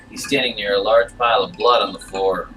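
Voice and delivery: usual speaking voice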